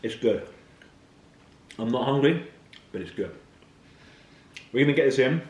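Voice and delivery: talking through a mouthful; words round a bite of food; mouth full. Real, not acted. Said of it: "first mouthful".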